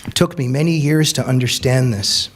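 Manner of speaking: ordinary talk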